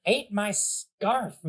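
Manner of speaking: put-on high, slow, sulky kid voice; petulant parting line. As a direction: spit it out like a sore loser. Bowser Junior's parting shot.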